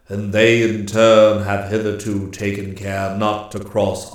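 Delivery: loud, measured voice of an immortal; stately master woodsman. A narrator's reading. Master woodsman assures Claus of protection, stately and grave. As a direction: deliver a grave, weighty pronouncement.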